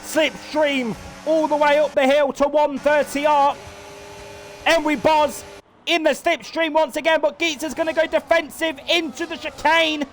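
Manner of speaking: energetic motorsport commentator voice; live race commentary. Acted. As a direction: call the race lap by lap like a broadcaster.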